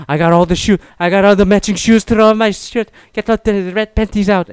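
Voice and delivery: hammed-up Irish trash talk, admittedly failing at the accent; attempted Irish accent. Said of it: "I can't do an Irish accent".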